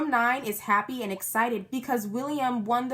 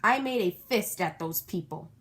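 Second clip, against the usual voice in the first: plain reading voice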